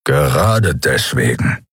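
low, slow growl; deep grumpy creature growl. A narrator's reading. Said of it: "knurrte Keru".

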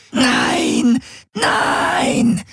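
repeated drawn-out screams, voice tearing upward; desperate screaming. Acted. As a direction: keep screaming in frantic refusal. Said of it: "schrie Ylana unaufhörlich". (unaufhörlich, verzweifelt schreiend)

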